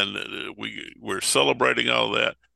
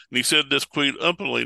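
ordinary talk, nothing marked

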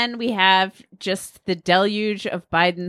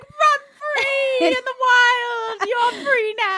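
much higher, slow shouted liberation cry; ecstatic shout; slower than usual. Pretend. Imagining Biden freeing children, high shouted cry. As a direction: fling the cages open and holler. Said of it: "and being like".

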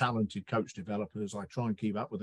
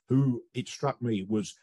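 ordinary talk

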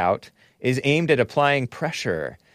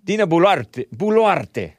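higher Italian-style flourish on the name; Italian-style accent; 5 semitones higher, 3 dB louder than usual. Real, not acted. Host hung up on a president's name, Italian-style accent. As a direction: say it as if it were Italian.

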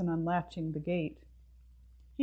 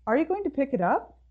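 exasperated question; 8 semitones higher, 4 dB louder, faster than usual. Acted. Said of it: "Mabel asked".

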